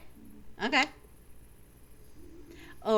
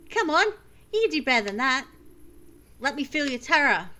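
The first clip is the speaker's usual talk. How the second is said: ordinary talk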